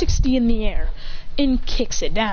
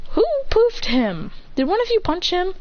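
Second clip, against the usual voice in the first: suspicious; 8 semitones higher than usual. Acted. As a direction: demand who did it. Clone poofed.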